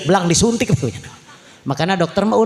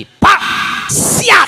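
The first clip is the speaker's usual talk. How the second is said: high, loud parade-ground yell; military shout; 6 dB louder than usual. Acted. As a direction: shout it like reporting for duty. Soldier son reports loudly.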